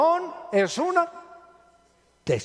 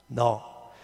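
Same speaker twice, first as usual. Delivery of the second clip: ordinary talk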